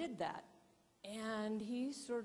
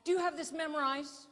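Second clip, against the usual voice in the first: much higher and louder, impatient; annoyed loud question; 7 semitones higher, 8 dB louder than usual. Retold or imitated. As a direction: demand it like an irritated cameraman. Angry photographer demands answer.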